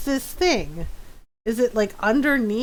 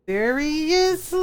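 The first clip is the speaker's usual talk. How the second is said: long drawn-out, rising disbelief; mock incredulous; 5 semitones higher, slower than usual. Player reacting to his prank pictures, mock incredulous. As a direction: stretch it out slowly, pitching up in playful disbelief.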